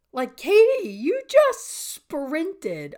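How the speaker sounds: high, loud, stretched-out disbelief; incredulous, drawn-out high exclamation; amazed self-talk. Retold or imitated. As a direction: exclaim it to yourself, astonished. Acts out amazed self-talk driving home.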